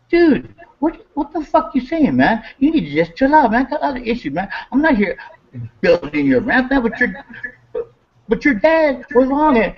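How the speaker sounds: high, heated comeback at his brother; reliving the fight with Jesse; retold angry retort. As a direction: push back hard, up high.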